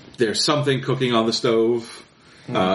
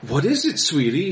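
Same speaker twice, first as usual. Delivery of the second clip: much higher, syrupy fake-sweet tone; sugary fake father; 7 semitones higher than usual. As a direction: play the kind daddy, falsely. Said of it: "and he goes".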